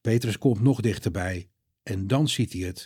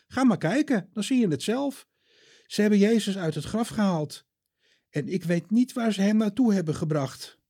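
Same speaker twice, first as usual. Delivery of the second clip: put-on higher, earnest young woman; Maria's voice; 9 semitones higher than usual. Maria tells Peter and John, higher earnest voice. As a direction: voice Maria, upset and eager.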